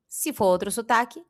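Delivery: ordinary delivery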